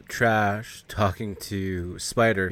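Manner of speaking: ordinary talk, nothing marked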